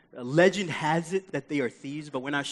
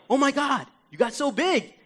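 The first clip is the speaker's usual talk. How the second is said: high-pitched mock amazement; awkward gushing; 9 semitones higher than usual. Adult meeting a friend's grown kid, awkward gushing. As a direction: gush it high, like a flustered family friend. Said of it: "you don't even know what to say. You're like".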